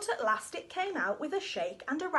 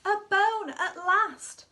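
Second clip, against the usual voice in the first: slow excited reveal; 5 semitones higher, 7 dB louder, slower than usual. A narrator's reading. Dog finally finds bone.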